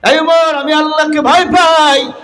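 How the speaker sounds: high, ringing proclamation; impassioned declaration. Acted. Preacher voicing Umar who fears God, impassioned high cry.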